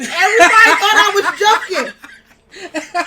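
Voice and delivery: very loud, high outburst over laughter; exasperated exclamation. Real, not acted. Storyteller on nobody believing her, loud amused exasperation. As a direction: blurt it out in amused disbelief.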